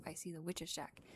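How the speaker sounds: hushed storyteller whisper; whispered narration. Acted.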